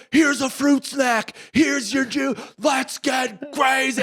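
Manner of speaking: high, slow excited shouting; hype shout. Retold or imitated. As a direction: pump him up, hand him snacks. Dad hyping son in car.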